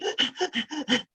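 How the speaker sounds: short breathless gasp; sprinting gasp. Shown as a demonstration. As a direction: gasp like a runner. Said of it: "That's the kind of breathing".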